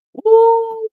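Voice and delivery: a high, loud whoop of joy; real excited whoop. Real, not acted. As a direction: whoop like the dream signing just landed. Excited at dream midfield.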